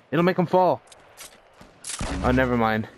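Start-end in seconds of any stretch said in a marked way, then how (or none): 0.1-0.8 excited callout. Real, not acted. Urgent callout to teammate.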